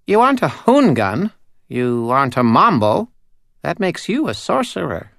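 high, tiny, eerie doll voice; a small uncanny doll spirit speaking. Acted. Doll judging the wizard.

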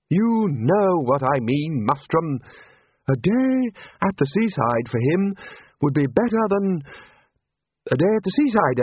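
coaxing wizard. Acted. Wizard talks the Arch-Chancellor round, coaxing. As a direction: wheedle your superior gently.

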